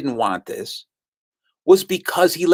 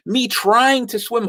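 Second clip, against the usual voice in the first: ordinary talk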